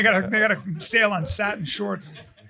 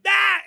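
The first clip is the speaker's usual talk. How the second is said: sudden high "Ah!" to startle someone; scare yell; 11 semitones higher, 4 dB louder than usual. Retold or imitated. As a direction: imitate jumping out with a yelp. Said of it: "I fucking scared her again".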